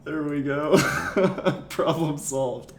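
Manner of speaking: laughing. Real, not acted. Laughing at the trick.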